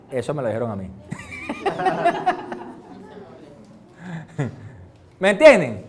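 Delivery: normal talking voice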